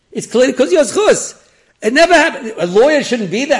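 loud, high retold outburst; excited exclaiming. Litigant crediting the man learning for his win, loudly excited. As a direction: burst out with it, imitating an amazed man.

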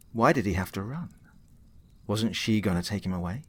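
ordinary delivery

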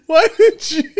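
words dissolving into high laughter; can barely speak for laughing. Real, not acted. Breaks up after the spit.

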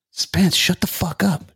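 mock-stern scolding of a friend; exasperated rebuke. Telling Spence to stop embarrassing him, exasperated.

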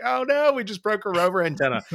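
high-pitched pretend alarm, sliding down; mock dismay. Someone who just snapped the antenna off, mock dismay. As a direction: play up a hammy oh-no. Acting out crew breaking antenna.

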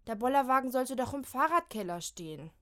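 whining. Acted. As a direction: moan about the attic. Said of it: "jammert Molly". (jammernd klagen)

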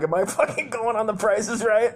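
high, loud excited question; excited disbelief. Retold or imitated. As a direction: blurt it out like an overexcited contestant. Acting out his excited childhood reaction.

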